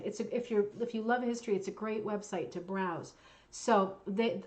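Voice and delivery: ordinary delivery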